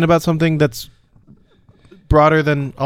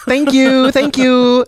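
squeaky grateful voice, pitched up; high mock thanks; 10 semitones higher, 4 dB louder, much slower than usual. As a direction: play it as a gushing, hammy thank-you.

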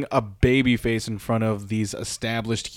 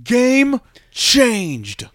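mock commentator; 11 semitones higher, 4 dB louder than usual. Quoting the commentator's big line.

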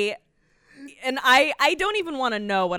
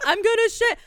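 high, loud panicked blurt; hammed-up urgent outburst; mock desperate cry; 12 semitones higher, 6 dB louder than usual. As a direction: cry out as if about to burst. Imagined desperate plea to a cop.

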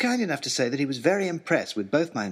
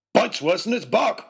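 higher, louder gruff joke; joking Oxshott; 8 semitones higher, 4 dB louder than usual. Acted. Oxshott joking over the dangling wolf, higher louder gruff voice. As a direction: toss off the quip with relish. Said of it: "he joked".

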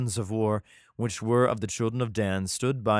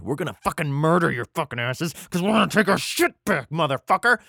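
louder, higher hammed-up gangster threat; furious threat; 5 semitones higher, 5 dB louder than usual. Children of Dan threatening Micah, hammed-up furious threat. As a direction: yell it like a mock angry mob.

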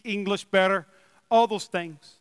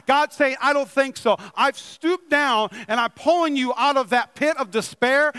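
excited preaching; 4 semitones higher than usual. Real, not acted. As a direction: preach it with full passion, lifting the whole room. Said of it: "I'll calm down. I'm just excited".

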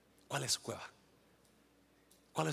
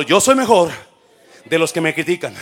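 loud declaration; 3 semitones higher, 18 dB louder than usual. Shown as a demonstration. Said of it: "dígalo".

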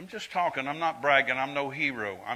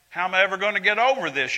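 higher, louder, fast hopeless whine; mock despair; 5 semitones higher, 5 dB louder, faster than usual. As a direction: mimic someone who has given up. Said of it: "You're not talking like that".

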